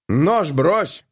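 high, sharp barked command; barked order. A narrator's reading. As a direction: bark it at him. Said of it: "рявкнул сержант".

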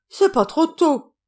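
relieved shout. Acted. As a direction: bellow it out, finally relieved. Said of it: "cria le capitaine".

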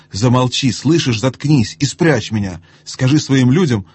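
ordinary delivery

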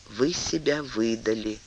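soft and slow, almost under breath; the queen quietly telling the duke he betrayed himself, hushed. A narrator's reading. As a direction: say it softly, a gentle reproach. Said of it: "тихо сказала королева".

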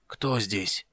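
a weak, hoarse rasp; hoarse croak. Acted. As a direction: croak it out from the sickbed. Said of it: "прохрипел".

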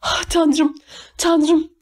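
high, lightly acted exclamation of alarm; narrated panic, pitched well up; panicked cry. A narrator's reading. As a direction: cry out high without much extra volume. Panicking mother cries out.

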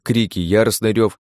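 ordinary delivery

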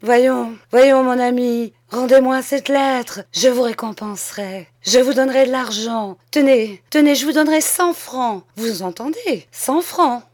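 loud, high-pitched pleading; desperate coaxing with offers of money; imploring. A narrator's reading. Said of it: "implorant comme un enfant qui pleure".

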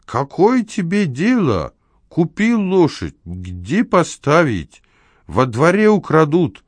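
high, slow, heavily stretched retort; suddenly angry. A narrator's reading. Neighbour arguing with the house manager about his horse, angry. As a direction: snap back in drawn-out indignation. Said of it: "Шаринов вдруг рассердился".

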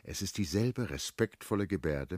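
ordinary delivery

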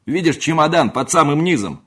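loud, high, irritated dismissal; cross servant scolding at full voice; angry shout. A narrator's reading. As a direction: shout the maid away crossly. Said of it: "сердито закричал на неё дородный лакей".

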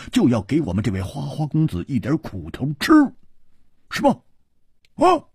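high, animated, quickening banter; lively high-pitched voice ending on squeaky questions; boisterous joker character. A narrator's reading. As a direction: argue it with gleeful theatrical emphasis.

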